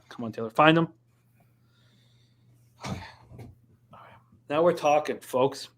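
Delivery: ordinary delivery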